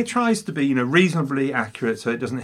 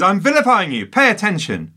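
mock yell; 8 semitones higher, 5 dB louder than usual. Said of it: "Peter O'Toole is yelling".

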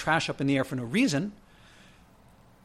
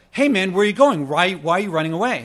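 taunting call; 3 semitones higher, 6 dB louder than usual. Retold or imitated. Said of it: "He's like".

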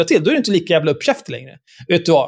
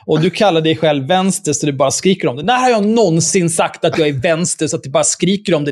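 usual speaking voice

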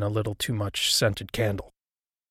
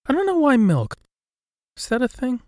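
puzzled; 13 semitones higher, 4 dB louder than usual. Real, not acted. Puzzled aside about milk.

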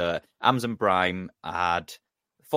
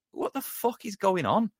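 disbelief; 12 semitones higher than usual. Retold or imitated. Reacting to Klopp's rant.

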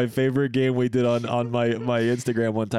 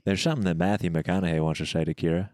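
Matthew McConaughey; 6 semitones lower than usual. Pretend. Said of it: "We gotta have Matthew McConaughey on here".

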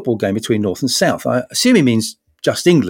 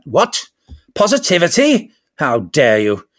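mock outrage; 4 semitones higher, slower than usual. Mock outraged cynical Brit.

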